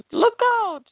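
high, loud alarmed cry; mock heroic yell of warning; shouted warning. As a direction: call out the danger sharply.

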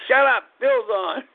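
high-pitched, irritable retold outburst; crabby snapping. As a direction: replay yourself rudely hushing someone, voice jumping up. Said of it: "I was a son of a gun".

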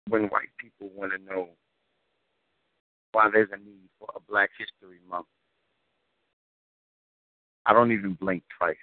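rhythmic, weighty poem recital; spoken word poetry. Acted. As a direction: deliver it like a slam poem.

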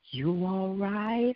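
slow, soft, drawn-out question; imitated calm check-in. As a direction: ask it unhurried, imitating his calm. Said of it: "He was so calm".

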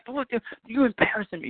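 ordinary delivery

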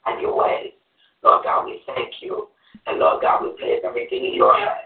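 fervent, earnest congregational prayer; closing prayer. Real, not acted. Woman closing the Bible study in prayer, earnest prayer. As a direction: pray it aloud, calling on God phrase by phrase.

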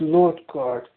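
normal talking voice

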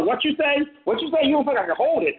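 high, challenging corner taunt; a playful battle-rap dare; mock battle taunt. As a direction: goad them like a street battle rapper. Host acts out battle rap taunt.

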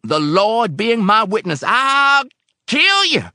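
high, loud, halting oath; murderous threat. A narrator's reading. Old man swearing to kill helper, raging. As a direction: build to a screamed threat.

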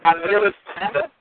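bad Bluetooth line, drowned sound; underwater phone voice. Real, not acted. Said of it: "like you're under a hundred feet of water".

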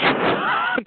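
high breathless cackle at her own joke; a squeaky, breathy giggle; real laugh. Real, not acted.

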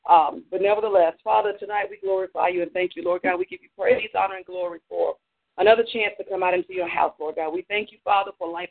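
usual speaking voice

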